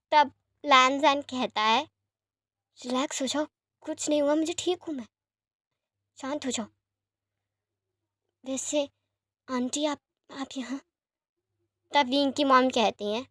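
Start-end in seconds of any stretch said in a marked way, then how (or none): 2.8-10.8 softer, frail patient just waking up; hushed, tired reassurance from a hospital bed; weak soft voice. Acted. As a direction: speak faintly, like someone barely recovered. Sick Lan Jan reassures Wing.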